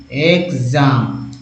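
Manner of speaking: Exam drawn out very slowly, each syllable separate; slow syllable demo. Shown as a demonstration. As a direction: say exam slowly so students catch it. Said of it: "teacher sounds out word". (Exam को बहुत धीरे-धीरे बोलना)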